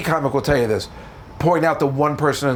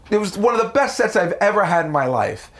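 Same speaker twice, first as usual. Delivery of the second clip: ordinary delivery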